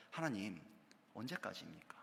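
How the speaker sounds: a soft, sinking plea to God; whispered-like lament; hushed prayer. Acted. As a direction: barely voice the lament. Quiet despairing prayer quoted.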